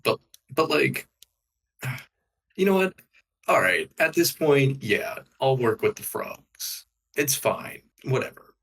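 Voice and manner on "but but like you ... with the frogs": usual speaking voice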